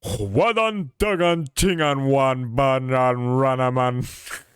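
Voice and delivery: loud, slow, hammed-up Islander gibberish; mock Islander accent. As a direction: put on an exaggerated Islander accent. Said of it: "Was that your Islander accent?".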